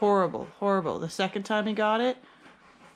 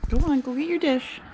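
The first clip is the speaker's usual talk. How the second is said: higher and louder, calling Paulo over; calling the dog; 6 semitones higher, 5 dB louder than usual. Real, not acted. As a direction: call out to the dog across the room.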